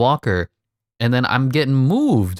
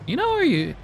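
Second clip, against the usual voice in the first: very high-pitched, goofy "you know"; playful squeaky rambling; silly high voice; 3 dB louder than usual. Pretend. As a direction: push it up into a comic squeal. Goofing on his own catchphrase.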